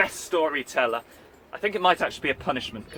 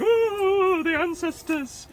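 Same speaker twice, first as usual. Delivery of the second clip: much higher, squeaky panicked cry; fleeing orc; 4 dB louder, much slower than usual. Retold or imitated. Orcs fleeing the dog, high panicked wail. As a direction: wail it like a terrified orc running off. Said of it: "they'd run away going".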